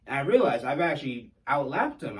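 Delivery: normal talking voice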